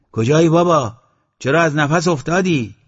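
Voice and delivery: high, very loud questions; shouting across. A narrator's reading. Man on the porch shouts back to Eslam, loudly. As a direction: holler the questions over a distance.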